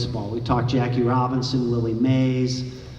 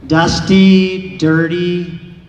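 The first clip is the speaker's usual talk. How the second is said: louder, punched out one word at a time; emphatic; 3 semitones higher, 8 dB louder, much slower than usual. Real, not acted. Speaker showing the audience the shoes, loud and emphatic. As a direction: hit each word hard as you list them.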